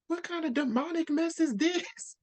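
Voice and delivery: very high-pitched mock horror; appalled disbelief. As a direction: squeal it out, aghast and hammed-up. Acted appalled reaction to gruesome killing.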